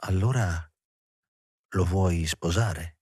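slow, subdued sad question; low, wistful, hesitant question; veiled sadness. A narrator's reading. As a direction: ask it slowly through a veil of sorrow. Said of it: "come se si stendesse un velo di tristezza".

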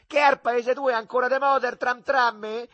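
high, loud piling-on jeer; yelled taunt. A narrator's reading. Lenzetta adding to the insults, shouted mockery. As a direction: pile on the mockery at a yell. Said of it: "urlò per incarare la dosa".

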